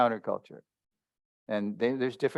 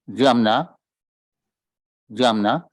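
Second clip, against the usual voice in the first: put-on Bengali accent; 6 dB louder, slower than usual. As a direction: call "Jamna, Jamna" the Bengali way, with a rise at the end. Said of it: "That's how he said Yamuna, the Bengali accent".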